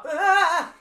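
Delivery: high loud panicked yelp; realising he must run, loud yelp. Retold or imitated. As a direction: cry out in alarm. Sudden urge to run.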